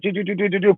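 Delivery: high, rapid-fire "do do" noises; high staccato dribbling imitation; imitated dribbling sound. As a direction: mimic a quick dribble with your voice. Imitates dribbling between the legs.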